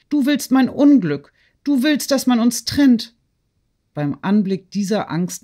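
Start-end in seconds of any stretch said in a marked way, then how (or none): 0.1-3.1 high-pitched, wounded reproach; agitated accusation. A narrator's reading. Woman accusing her friend of separating the lovers, agitated. Natalie accuses Sonja in a quarrel.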